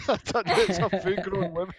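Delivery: ordinary delivery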